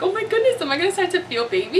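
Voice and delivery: high, quick excited gush; really excited. Retold or imitated. Mother-to-be expecting to feel baby move, really excited. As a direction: re-enact a squeal of anticipation. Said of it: "I started to get really excited".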